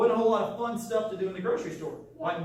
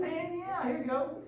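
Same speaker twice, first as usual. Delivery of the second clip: cheerful, higher, smiling offer; sharing with a smile; 7 semitones higher, 5 dB quieter than usual. Acted. Teacher gladly lends his phone to a student, smiling and cheerful. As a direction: hand it over happily, smiling. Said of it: "I can share with a smile".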